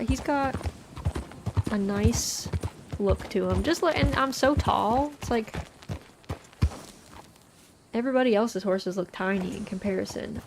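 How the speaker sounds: ordinary talk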